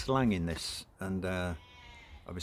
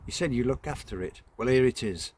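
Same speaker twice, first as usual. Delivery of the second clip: brisk dismissive mother; 5 semitones higher, 7 dB louder than usual. Acted. Mother hands over child.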